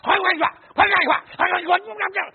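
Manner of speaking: high, loud, rapid-fire what what what, mock fury; rough angry rant. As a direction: imitate a flight attendant sounding ready to fight. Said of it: "I thought she was about to get in a fight".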